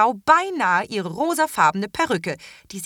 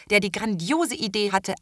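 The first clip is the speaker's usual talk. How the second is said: ordinary delivery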